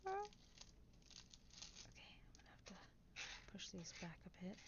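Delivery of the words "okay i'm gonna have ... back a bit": soft unvoiced whisper. Real, not acted. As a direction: whisper it to yourself. Quiet building asmr whisper.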